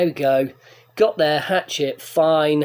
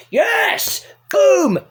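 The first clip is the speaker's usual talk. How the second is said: triumphant cheer; 11 semitones higher, 5 dB louder than usual. Retold or imitated. Finds his bus, celebrates.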